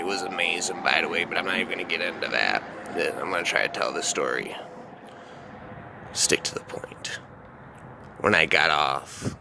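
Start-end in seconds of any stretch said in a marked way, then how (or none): 6.1-7.2 whispered aside to self. Real, not acted. Reminding himself mid-story.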